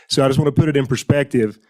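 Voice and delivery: ordinary talk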